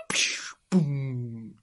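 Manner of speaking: voiceless whoosh into a low boom; beam and blast noise. A narrator's reading. Hero's beam strikes the alien, whooshing explosion noise. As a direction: imitate a laser hit with your mouth.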